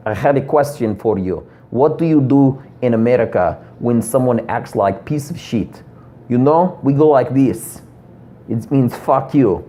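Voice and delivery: lightly put-on Russian accent, a bit slow; a light imitation of Russian friend Dimitri. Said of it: "my friend Dimitri, he was just like".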